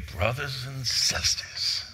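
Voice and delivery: slow, drawn-out Southern preacher greeting; Southern accent. Shown as a demonstration. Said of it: "Do you do the Southern accent?".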